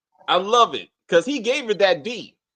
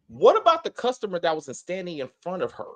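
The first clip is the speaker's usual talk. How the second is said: ordinary talk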